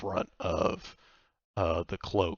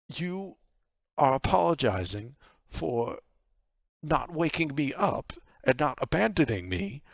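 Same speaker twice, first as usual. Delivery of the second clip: higher-pitched, affronted woman cutting him off; incredulous rebuke in a raised pitch; indignant Vaku; 7 semitones higher, 4 dB louder than usual. Acted. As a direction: say it in disbelief, pausing between phrases. Game master plays Vaku cutting in.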